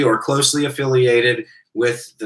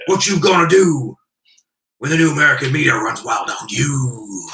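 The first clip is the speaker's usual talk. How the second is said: wrestling promo voice; 2 semitones higher than usual. Acted. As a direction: cut a pro wrestling promo. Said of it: "I started doing a wrestling voice".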